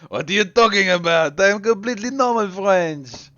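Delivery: hammed-up French accent, high and loud; crazy French accent. Streamer clowning as normal French for viewers, crazy French accent. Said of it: "my pathetic French and the crazy accent".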